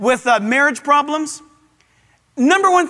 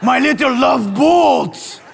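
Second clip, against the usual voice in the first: Pepe Le Pew voice; slower than usual. Retold or imitated. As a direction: croon it to the cat in a mock French accent. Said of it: "sees the stripe down her back and says".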